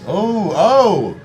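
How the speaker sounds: normal talking voice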